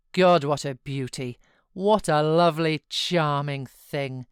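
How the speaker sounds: loud, sneering mock praise; scornful exclaim. A narrator's reading. Heathcliff mocks his frail son to Nelly, loud and scornful. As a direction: sneer out the compliment. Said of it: "uttered a scornful laugh".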